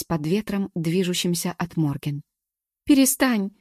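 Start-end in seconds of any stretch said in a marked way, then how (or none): 2.8-3.5 put-on high, loud crying plea like a child; crying plaintively. A narrator's reading. Galka begging Morgen to stop, crying like a child. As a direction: beg her to stop, weeping like a child. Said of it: "заплакала она жалобно, как ребёнок".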